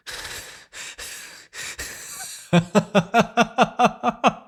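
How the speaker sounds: long breathy villain cackle, pitched high; evil laugh. Acted. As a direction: cackle in cruel triumph. Villain gloats over hero.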